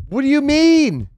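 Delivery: high, loud, slow shocked question; shocked cry. Real, not acted. Player stunned by character's words, high shocked cry. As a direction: blurt it out in disbelief.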